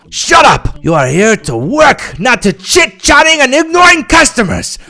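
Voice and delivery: high, sharp telling-off; angry reprimand. A narrator's reading. Manager silences shoe sales girl, angry. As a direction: cut them off, pitch jumping high.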